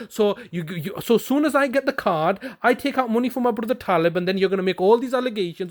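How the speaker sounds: ordinary delivery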